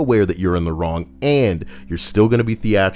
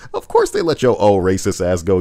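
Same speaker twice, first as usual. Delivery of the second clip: normal talking voice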